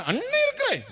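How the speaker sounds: very high mocking voice; mock brother. Preacher mocking brother, high falsetto. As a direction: parody the empty promise. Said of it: "அண்ணே வருவான்".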